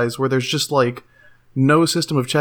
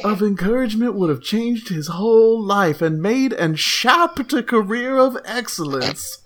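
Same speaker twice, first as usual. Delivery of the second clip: mock indignant forum rant; 8 semitones higher than usual. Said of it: "reading out forum post mockingly".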